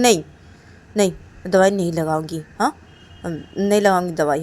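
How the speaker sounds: loud, scolding, indignant; angry refusal. Acted. Angry Reshma scolding her friend Kajal. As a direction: snap back at her for touching the suit.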